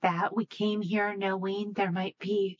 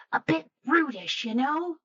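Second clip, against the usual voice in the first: a higher, prim, mildly snooty aside; haughty aside; 6 semitones higher than usual. Acted. Malika apologising for Uther to the ghost, prim aside. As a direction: puff up and sniff at him. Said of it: "puffs up her shoulders".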